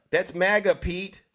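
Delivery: ordinary talk, nothing marked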